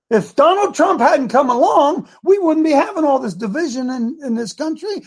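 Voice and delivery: high, loud sneering impression; mock the enemies, building to a shout; sarcastic shout. Ranting preacher mocking opponents building to a loud shout.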